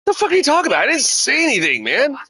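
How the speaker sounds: high-pitched retold protest of innocence; indignant protest.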